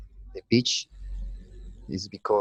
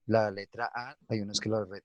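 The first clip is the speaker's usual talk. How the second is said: usual speaking voice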